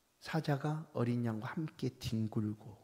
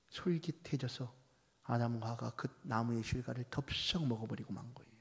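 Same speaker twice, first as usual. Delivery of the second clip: ordinary talk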